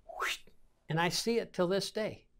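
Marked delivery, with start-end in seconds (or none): 0.0-0.4 soft whoosh of a thrown grenade; short airy swish, under the voice; mouth-made sound of a throw; imitated throw.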